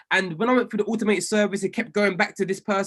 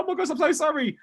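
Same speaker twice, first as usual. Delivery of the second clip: higher, gushing mock apology; apologetic woman; 8 semitones higher, faster than usual. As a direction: play the flustered company rep saying sorry. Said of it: "The woman was so sorry".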